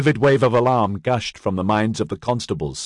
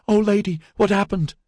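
alarmed; 12 semitones higher than usual. A narrator's reading. Canteen alarmed.

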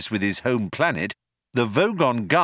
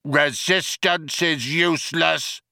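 slow, higher mock shout; guard's shout; 5 semitones higher than usual. Vogon guard repeating his slogan at prisoners, shouting. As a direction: chant the slogan slowly, drawn out. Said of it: "the guard shouting again".

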